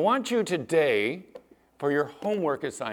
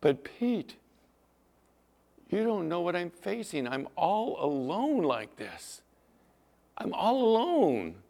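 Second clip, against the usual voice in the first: a listener whining that they are alone; whiny doubter; 4 semitones higher than usual. Retold or imitated. Listener's objection voiced by the preacher.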